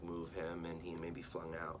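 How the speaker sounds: plain reading voice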